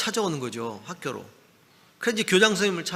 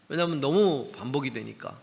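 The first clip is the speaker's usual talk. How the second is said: ordinary talk, nothing marked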